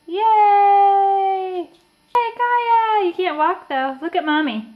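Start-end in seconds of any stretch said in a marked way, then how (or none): 0.1-3.1 high, loud delighted cheer; real excited shout, higher and louder. Real, not acted. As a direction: cheer her on with a squeal of delight. Baby walks in shoes.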